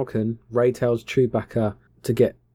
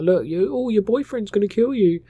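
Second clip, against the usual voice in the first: high, singsong mocking voice; mocking taunt; 10 semitones higher than usual. Snoke mocking Rey in a high singsong taunt. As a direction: taunt her like a playground bully. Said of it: "mocking Rey".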